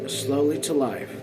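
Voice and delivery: ordinary delivery, nothing marked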